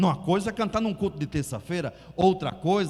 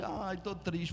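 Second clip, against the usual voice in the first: soft, much quieter mopey sigh; a hammed-up gloomy moan; mock sad sigh; 10 dB quieter, slower than usual. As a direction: whine it as if feeling sorry for yourself. Mimics a sad person.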